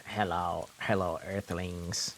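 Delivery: low, slow alien greeting; deep spacey alien voice. Retold or imitated. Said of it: "The alien goes".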